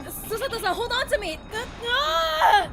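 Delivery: high, loud panicked cry; alarmed shout. Acted. Ryunosuke to Susato as the ship lurches, alarmed shout. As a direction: shout out in alarm, ending in a scream.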